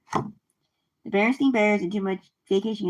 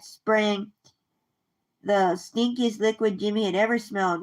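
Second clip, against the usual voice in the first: ordinary delivery, nothing marked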